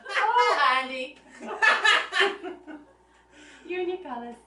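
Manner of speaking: fond, giggling aside breaking from the reading; high, amused sigh at her own story; laughing interjection. Real, not acted. Reader laughing fondly at the friend in her tale. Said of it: "So that's my interjection".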